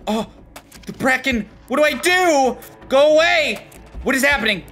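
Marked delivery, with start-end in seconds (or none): 1.7-4.6 high-pitched panicked cry; raised, alarmed voice. Real, not acted. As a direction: plead for it to leave. Cornered by the Bracken.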